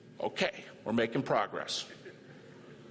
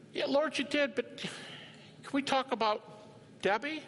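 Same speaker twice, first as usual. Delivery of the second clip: pleading with God; 11 semitones higher, slower than usual. Pretend. Pleading with the Lord.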